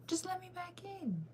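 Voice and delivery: way up high, slow and soft, begging; high whiny plea. Real, not acted. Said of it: "repeats, begging".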